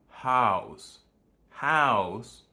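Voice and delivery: "House" with the open American vowel as in father. Shown as a demonstration. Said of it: "The vowel used in American house is the same one used in father".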